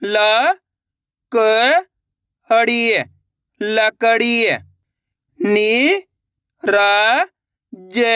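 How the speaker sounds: each syllable said apart, drawn out and rising; teacher sounding out each syllable. Shown as a demonstration. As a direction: spell it out slowly, one sound at a time. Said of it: "अब आगे देखिए".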